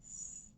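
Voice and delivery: an unvoiced hissing sss; hissed S. Shown as a demonstration. Teacher modelling the S sound, a hissed sss. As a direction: hiss the S with no voice. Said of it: "Say it with me".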